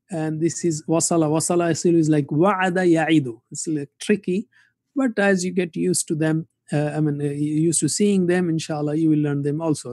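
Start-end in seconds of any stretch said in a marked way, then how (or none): none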